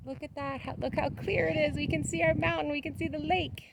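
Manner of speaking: high, soft awed wonder. Real, not acted. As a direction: marvel at the view, hushed. Admiring the clear sky.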